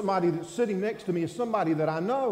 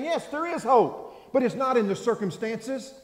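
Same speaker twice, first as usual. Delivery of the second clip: preaching; 8 semitones higher, 8 dB louder than usual. Acted. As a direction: build to a fervent proclamation. Preaching at full force.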